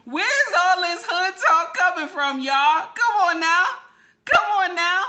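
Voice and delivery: loud, high-pitched incredulous demand; exasperated shout. Real, not acted. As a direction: call them out at full volume.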